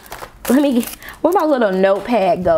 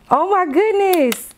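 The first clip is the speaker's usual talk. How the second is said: high, drawn-out delight; 10 semitones higher than usual. Real, not acted. Vlogger loving a pen, high delighted swoon.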